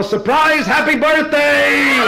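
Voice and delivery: high, loud group yell of surprise; hollered surprise. Retold or imitated. Forty guests yelling at the naked husband. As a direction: shout it like a room full of party guests.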